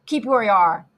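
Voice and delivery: loud command to halt; called order. A narrator's reading. Guard to the voice in the mist, calling out. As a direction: call it out firmly. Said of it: "the guard called to the voice".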